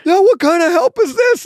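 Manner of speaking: very high, loud mock-outraged squeal; squeaky Carl. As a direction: shriek it like a betrayed fan. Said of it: "And he goes".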